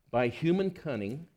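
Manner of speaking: ordinary talk, nothing marked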